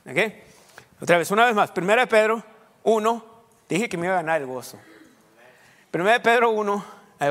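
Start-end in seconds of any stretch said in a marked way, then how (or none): none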